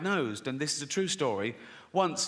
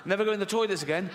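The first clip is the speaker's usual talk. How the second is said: quick, higher-pitched retold warning; rattled-off warning; 4 semitones higher, faster than usual. Mum in imagined exchange with her son, rattled off. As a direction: rush through the mum's warning.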